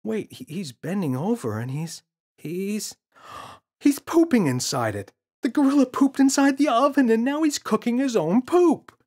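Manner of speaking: put-on a frustrated boy pleading to be believed; insistent boy. James voiced in story.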